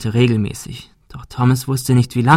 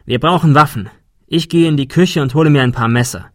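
higher, resolute, businesslike voice; firm planner; 4 semitones higher, 4 dB louder than usual. A narrator's reading. A man laying out the plan to the group: resolute, higher.

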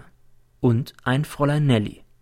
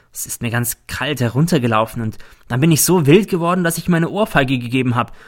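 plain reading voice

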